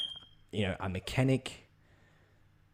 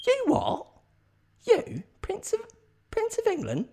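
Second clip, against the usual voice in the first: squeaky, very high disbelief; hammed-up high-pitched astonishment; incredulous. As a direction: squeal it like a gobsmacked mate. Offsider acts stunned disbelief.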